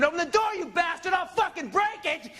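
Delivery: put-on high, frantic girlfriend's yell; high-pitched acted screaming; mock scream. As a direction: imitate her raging at the door. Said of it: "and she screamed".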